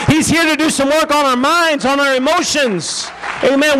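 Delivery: rising, fervent preaching. Acted. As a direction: preach it to the congregation. Preaching at full force, building.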